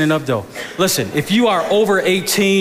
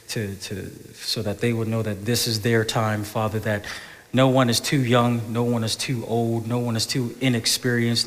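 soft, low, unhurried praying voice; prayer; 6 semitones lower, 7 dB quieter than usual. Real, not acted. Pastor praying to the Father for the congregation, hushed prayer. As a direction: pray quietly with reverent pauses.

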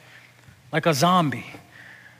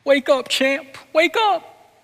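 put-on much higher pleading child's cry, rising each time; a child's voice climbing higher with each wake up; desperate boy crying; 11 semitones higher, slower than usual. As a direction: beg a fallen father to wake. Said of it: "Ricky Schroder just starts yelling".

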